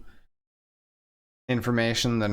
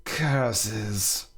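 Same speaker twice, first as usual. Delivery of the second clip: sneer. Acted. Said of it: "Guillaume says with a sneer".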